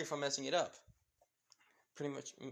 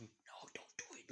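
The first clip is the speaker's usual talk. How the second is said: hushed, urgent whisper; silent plea; 16 dB quieter than usual. Acted. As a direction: mouth it almost without voice. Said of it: "silently telling him".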